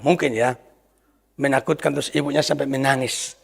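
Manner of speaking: ordinary delivery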